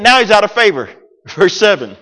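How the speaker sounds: ordinary delivery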